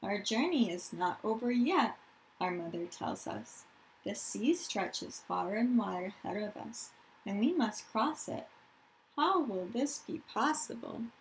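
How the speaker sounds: put-on reading aloud to young children; story narration. A narrator's reading. Teacher reads a refugee story to her class, storytelling voice. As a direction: tell the story gently to the class.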